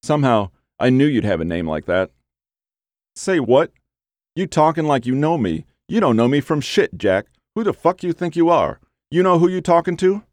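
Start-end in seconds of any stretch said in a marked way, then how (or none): 3.2-10.2 angry. A narrator's reading. Thief angrily challenges caller.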